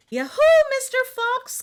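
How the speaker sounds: high, loud call across the yard; bright hollering call to the fox. Acted. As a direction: hail the neighbour from outside. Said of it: "called Missus Bear".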